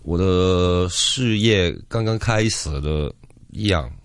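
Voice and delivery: ordinary talk, nothing marked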